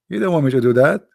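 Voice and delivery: ordinary delivery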